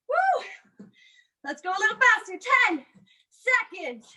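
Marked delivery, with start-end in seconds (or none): 0.1-0.9 excited woo. Real, not acted. Cheering in final push.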